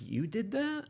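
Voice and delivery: surprised disbelief. Retold or imitated. Stunned reply to sergeant major.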